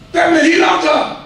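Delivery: punchline shouted high and loud; laughing shout. Real, not acted. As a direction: belt it out through laughter. Punchline about undemonstrative dad.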